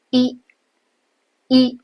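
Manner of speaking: 一 with lips barely spread; 一 as Japanese い, small lip movement. Shown as a demonstration. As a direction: keep the lips relaxed on 一. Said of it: "你的嘴唇不会往两侧咧开".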